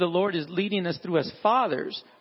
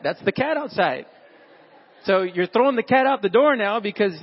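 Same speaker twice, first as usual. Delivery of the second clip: ordinary talk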